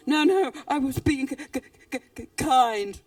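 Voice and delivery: stuck on "k-" before a loud "kind"; mild nervous stammer; flustered stutter. Acted. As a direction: stumble over the word, then blurt it. Snake stutters defending himself.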